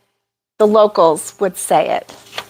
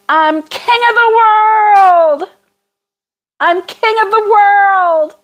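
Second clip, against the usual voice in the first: high, drawn-out triumphant cry; movie-quote holler; 10 semitones higher, 3 dB louder, slower than usual. Acted. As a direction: holler it like the actor in the film. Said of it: "why I have to say it like that".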